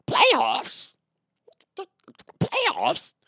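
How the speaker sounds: squeaky incredulous high-pitched Jim Mora yell. Retold or imitated. Mimicking a coach's disbelieving rant to the listeners, very high shout. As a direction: shriek the word like a coach who can't believe the question. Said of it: "The, uh, famous Jim Mora Senior".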